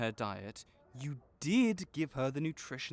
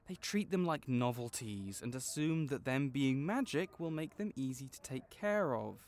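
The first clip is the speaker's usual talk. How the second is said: ordinary talk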